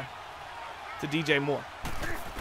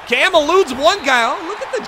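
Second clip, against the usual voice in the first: excited play call; 7 semitones higher, 6 dB louder than usual. Real, not acted. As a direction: call the escape with rising excitement. Live call of quarterback's escape.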